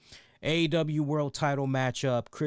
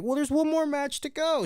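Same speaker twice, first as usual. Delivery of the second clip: mock self; 14 semitones higher than usual. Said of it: "I'm like".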